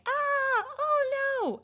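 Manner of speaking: high, loud cry of alarm; mock panic. Imitating believers who forget God, hammed-up high panic. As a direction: squeal it like sudden dread. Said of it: "we're like".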